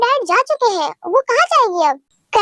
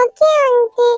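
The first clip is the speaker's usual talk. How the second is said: put-on high, childlike voice, hurt and pleading; a little girl complaining she was scolded; 4 semitones higher, much slower than usual. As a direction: talk like a small child telling auntie she did nothing. Child voice for Tae.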